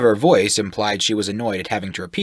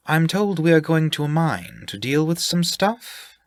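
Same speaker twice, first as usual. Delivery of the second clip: higher, airy and unsure; vague half-elf voice; 9 semitones higher than usual. Acted. Elwood vaguely explains the job, higher and unsure. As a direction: say it like you barely know why.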